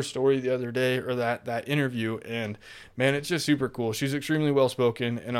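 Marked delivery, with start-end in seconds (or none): none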